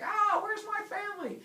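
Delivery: high-pitched mock panic; freaking out. Heracles surrounded by monsters, high panicked cry. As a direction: cry out in a squeaky comic fright. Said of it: "Heracles starts freaking out".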